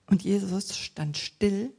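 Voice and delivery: usual speaking voice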